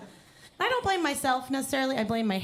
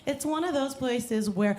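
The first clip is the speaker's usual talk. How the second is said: ordinary talk, nothing marked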